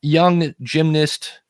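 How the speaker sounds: podcast host read. Shown as a demonstration. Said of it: "And if I say".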